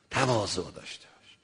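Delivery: low, soft word of advice; hushed exhortation. Real, not acted. Preacher repeating advice to listeners, hushed and low. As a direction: drop right down for تواضع.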